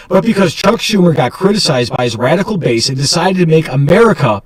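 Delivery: news commentary read. Acted. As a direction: deliver it like a cable news host. Scripted political news read.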